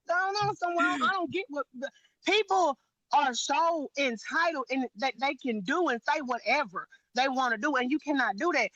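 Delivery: normal talking voice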